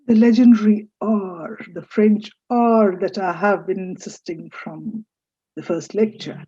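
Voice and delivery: usual speaking voice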